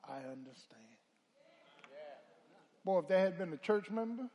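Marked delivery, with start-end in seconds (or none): 0.1-1.0 soft, gentle reassurance; gentle Lord's voice. Retold or imitated. Lord comforting a sinner at the bar, softly. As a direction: say it softly, like forgiving grace. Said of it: "I heard the Lord's voice say".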